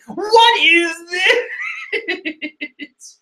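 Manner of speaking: very high, slow wail of disbelief; exasperated shriek. Real, not acted. Reader defeated by a strange name, wailing in disbelief. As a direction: wail it out, stretching every word.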